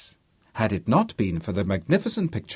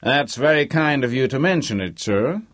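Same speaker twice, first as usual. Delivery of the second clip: slightly raised, respectful American guest; 4 semitones higher, 3 dB louder than usual. A narrator's reading. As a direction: answer an elder with deference. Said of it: "said Harvey respectfully".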